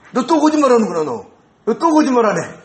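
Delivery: high, loud reproach with a punch on 또; a conscience catching you out; scolding inner voice. Retold or imitated. Conscience pricks the liar.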